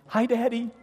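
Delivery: put-on high little toddler voice. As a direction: chirp it like a two-year-old. Toddler greeting dad through playpen.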